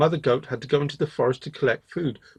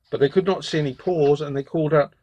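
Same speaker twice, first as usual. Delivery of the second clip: usual speaking voice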